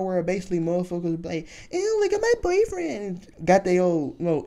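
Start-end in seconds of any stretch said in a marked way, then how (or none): 1.7-3.3 high, slow, mocking girlfriend gushing; mocking girly voice. Mimicking girls showing off their boyfriends, high mock gush.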